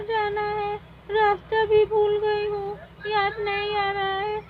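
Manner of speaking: put-on a lost girl sobbing for her mother; crying lost child. Poonam cries to the fairy queen that she is lost.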